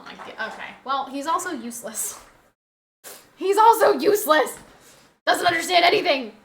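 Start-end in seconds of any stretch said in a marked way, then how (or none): 3.4-6.3 high, loud mock exasperation; raised-voice grumble about a pet; loud complaint. Real, not acted. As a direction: announce it loudly for all to hear. Louder repeat about useless dog.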